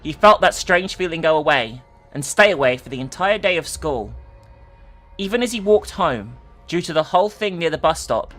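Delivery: usual speaking voice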